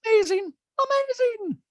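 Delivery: high, loud caricature of an impressed man; Rick voice. Retold or imitated. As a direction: squeal it in a high put-on voice. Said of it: "And Rick goes".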